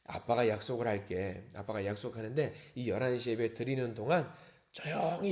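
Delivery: low, soft imitation of murmuring; hushed confiding. Dad makes his child a promise during worship, hushed. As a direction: lean in with a quiet, secret promise. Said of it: "아이에게 조용히 귓속말을 합니다".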